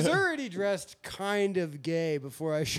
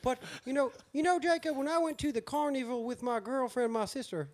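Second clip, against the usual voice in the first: put-on higher-pitched West Virginia hillbilly accent; sing-song West Virginia twang; West Virginia pillbilly; 6 semitones higher, 4 dB quieter than usual. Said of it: "I'll do a West Virginia pillbilly".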